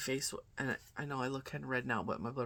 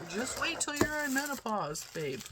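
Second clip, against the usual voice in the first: mock knowing viewers; 11 semitones higher than usual. Quoting viewers' comments.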